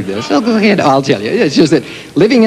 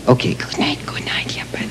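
unvoiced hush to the puppet; soft whispered goodbye to a puppet; 9 dB quieter than usual. Real, not acted. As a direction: whisper good night softly. Whispering goodnight to the watch.

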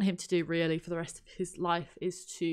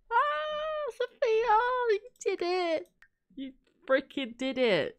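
high, slow joyful cheer; cheering for Sophia's graduation, high and slow with joy; overjoyed; 12 semitones higher, slower than usual. Real, not acted. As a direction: celebrate her win. Celebrating the graduation.

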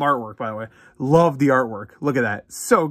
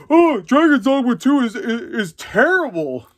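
higher, louder, stretched whiny complainer voice; mock YouTuber whine; 7 semitones higher, 3 dB louder, slower than usual. Mocking complaint videos, whiny high drawn-out voice. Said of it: "make videos about".

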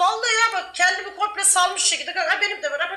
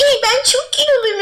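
mock self-pity; 8 semitones higher, 7 dB louder than usual. Mocking a friend's self-pity.

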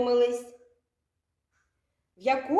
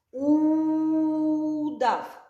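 Удав with the opening У held long and a bit louder; stretched first sound; 4 semitones higher, 3 dB louder than usual. Shown as a demonstration. Teacher stretching the first sound for children. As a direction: draw out the У of Удав. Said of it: "Слухайте уважно".

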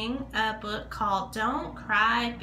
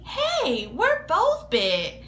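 much higher, eager cry; excited idea; 9 semitones higher, slower than usual. Acted. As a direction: burst out with a happy plan. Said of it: "said Big Bird".

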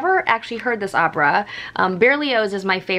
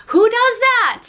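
higher and louder, playful disbelief; amazed exclaim; 13 semitones higher, 7 dB louder, slower than usual. Real, not acted. Thanking a viewer for an opera Blu-ray, loud playful disbelief. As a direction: toss it out, delighted at the thoughtfulness.

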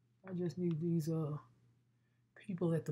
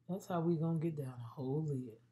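ordinary talk, nothing marked

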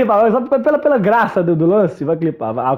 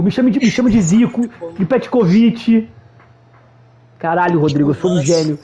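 ordinary delivery

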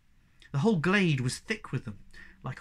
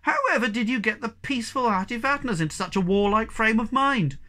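higher and louder call across the glade; 7 semitones higher, 6 dB louder than usual. A narrator's reading. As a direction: call up to the Hemulin on the pole. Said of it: "and then louder to the Hemulin".

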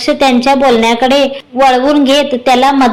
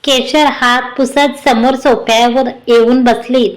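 ordinary delivery

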